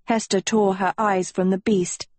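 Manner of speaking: plain reading voice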